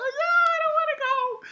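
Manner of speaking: very high squeaky whine; imitating a whining character; mock whiny voice. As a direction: whine like a kid.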